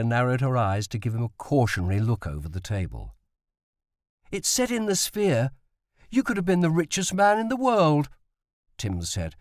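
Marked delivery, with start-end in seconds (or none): 4.3-8.1 put-on high, insistent young boy; a boy pressing his point, higher; boy's voice. A narrator's reading. Tim presses his father over supper, high and insistent.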